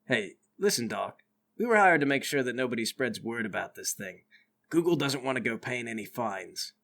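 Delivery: low-pitched warning to the doctor; armed guard. Acted. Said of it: "One of them says".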